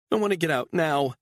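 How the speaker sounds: high, begging woman's voice; pleading mother. A narrator's reading. Mother begging her captor to let her go, high and pleading. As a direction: plead for your daughter's sake.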